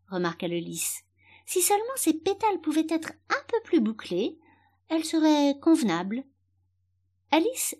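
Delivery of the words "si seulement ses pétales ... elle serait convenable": high-pitched, fussy appraisal; picky little flower voice; critical flower. Acted. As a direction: pass judgement in a put-on high voice. Flower critiques Alice's looks.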